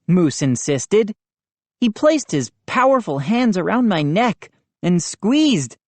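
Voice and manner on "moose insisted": plain reading voice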